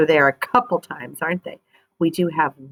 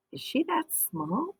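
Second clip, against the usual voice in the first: higher, softer amazed question; a child amazed at the baby; surprised question; 10 semitones higher, 11 dB quieter than usual. Acted. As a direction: peek in and marvel at how tiny. Boy peeks at tiny baby.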